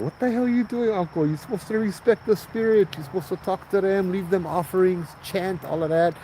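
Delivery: incredulous bystander. Retold or imitated. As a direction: scold the kahuna in disbelief. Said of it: "I would have been looking at the kahuna like he was crazy".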